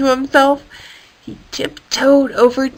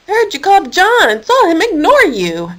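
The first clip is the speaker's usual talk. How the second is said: higher indignant telling; 8 semitones higher than usual. Acted. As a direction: sound offended for him. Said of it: "Keith said indignantly".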